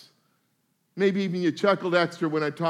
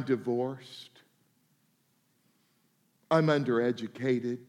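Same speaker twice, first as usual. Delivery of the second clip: downcast confession; 6 semitones lower, 4 dB quieter than usual. Retold or imitated. Congregant voicing shameful identity.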